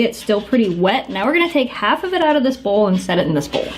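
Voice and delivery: usual speaking voice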